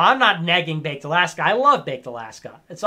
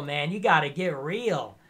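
usual speaking voice

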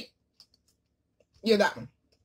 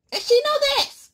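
exasperated; 6 dB louder than usual. Real, not acted. Exasperated high emphasis on friend.

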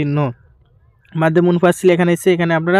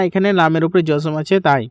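ordinary talk, nothing marked

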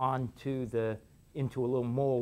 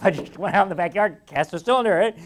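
laughing; 4 semitones higher, 8 dB louder, faster than usual. Real, not acted. Laughing about childhood toy cylinder.

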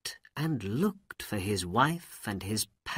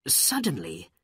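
ordinary delivery

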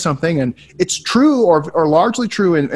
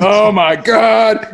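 a slow, stretched-out, laughing "oh my God"; a long, slow groan of mock shock; stunned drawn out; 6 semitones higher, 4 dB louder, much slower than usual. Real, not acted. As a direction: drag each word out in amused disbelief. Reacting to a cheeky listener letter.

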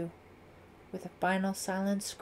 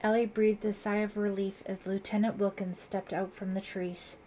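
plain reading voice

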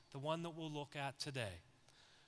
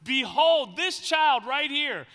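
loud, higher, urgent preaching; preaching at full force; 7 semitones higher, 14 dB louder than usual. Acted. Pastor preaching about Mary to the church, loud and urgent. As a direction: drive it home to the congregation.

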